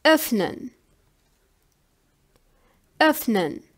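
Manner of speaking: "öffnen" with a short rounded ö at the start; umlaut example. Shown as a demonstration. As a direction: say "öffnen" as a careful German model word. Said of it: "for example".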